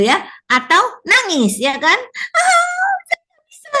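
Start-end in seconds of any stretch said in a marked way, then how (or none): 2.3-3.7 very high, mock tearful wail; crying child. Teacher imitating a child who can't tie a shoe, mock crying. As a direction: wail it like a frustrated little kid about to cry.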